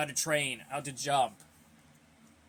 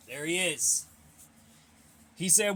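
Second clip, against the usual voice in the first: ordinary talk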